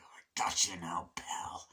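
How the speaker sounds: whispered gloating threat; sneaky whisper. Retold or imitated. Rooney sneaking up on Ferris.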